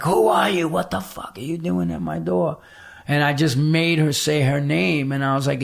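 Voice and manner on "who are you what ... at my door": a hint of a suspicious old woman snapping, first words higher; lightly voiced grumpy old lady at the door; mock miserable old woman. Old woman to the stranger at her door, mock indignant. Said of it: "She's like".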